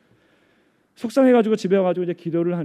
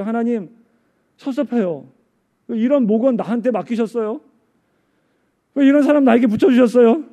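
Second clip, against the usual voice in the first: raised, aggrieved protest to God; resentful complaint; 6 semitones higher, 10 dB louder than usual. Acted. Weary group leader asking God why these members, resentful. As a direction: act out a hurt prayer, building to a loud high cry. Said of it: "하나님께 원망할 수도 있어요".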